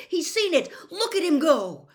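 high, loud cheering cry; excited shout. A narrator's reading. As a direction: urge the player on from the stands. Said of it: "Harry shouted".

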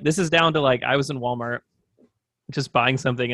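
usual speaking voice

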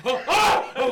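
loud, high yelp of alarm; startled mock scream. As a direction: cry out in mock shock. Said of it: "he's just gonna be like".

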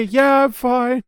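a slow, high, pained "I'm fine"; hurt partner calling back up, slow and high. Retold or imitated. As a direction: answer back up through clear pain. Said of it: "And then upstairs".

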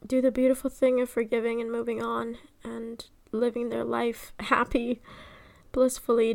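low, soft, unhurried prayer; spoken prayer. Real, not acted. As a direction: pray quietly, with halting pauses. Leads a closing prayer.

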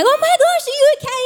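high-pitched mock alarm; hyper-concerned. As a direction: fuss over someone who fell, hammed up. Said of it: "like, hyper concerned".

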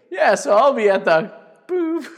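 laughing quote ending in a high boop; laughing through the quote. Retold or imitated. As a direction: giggle through it, then pop a high boop. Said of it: "And he's like".

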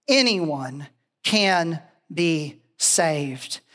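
"anyone can be saved" very slow, word by word, settling low; slow, emphatic declaration. Real, not acted. Preacher tells friends "anyone can be saved", slow and emphatic. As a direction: space out each word of "anyone can be saved" with solemn weight.

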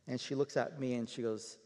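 normal talking voice

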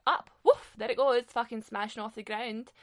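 mock gym grunting. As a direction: ham up a meathead heaving weights. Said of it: "This guy's like".

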